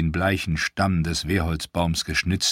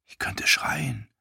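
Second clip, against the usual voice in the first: whispered thought; 7 semitones higher, 4 dB quieter, slower than usual. A narrator's reading. Theon's private thought.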